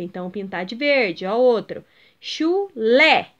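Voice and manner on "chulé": "chulé" split in two with a loud "lé"; stress on last syllable. Shown as a demonstration. Teacher giving pupils another example, stressing final "lé" in "chulé". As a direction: break "chulé" apart and punch "lé". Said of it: "O lé é a sílaba tônica".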